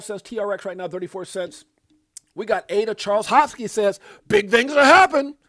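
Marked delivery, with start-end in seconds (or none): 4.3-5.4 loud, high proclamation; booming, excited announcement; hyped quote. Retold or imitated. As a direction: belt it out like big news. Quoting Charles's hype line.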